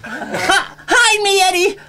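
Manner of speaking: frantic cartoon squeal; very high, loud, hammed-up pleading; Roger Rabbit. A cartoon rabbit begging for help, high shrieking. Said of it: "Mister Roger Rabbit".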